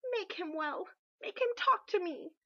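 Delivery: high, loud, frantic command; a queen clutching the physician's robe, demanding in panic; desperate demand. Acted. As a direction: grab his robe and beg like an order.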